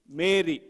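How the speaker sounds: "Mary" drawn out, pitch falling; emphatic MARY. Shown as a demonstration. Teacher answering who had the lamb, "Mary" stressed. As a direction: answer with a firm, stretched "Mary". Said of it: "You emphasize each word".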